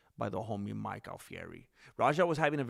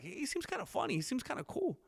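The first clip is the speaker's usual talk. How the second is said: squeaky, high, half-joking musing; high mock voice; 13 semitones higher, 4 dB quieter than usual. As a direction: jump way up high for the inner thought. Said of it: "and I'm like".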